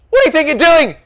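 high, loud scolding shout; outraged dad. A narrator's reading. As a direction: demand an explanation from your son. Dad yells at his son.

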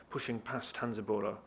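usual speaking voice

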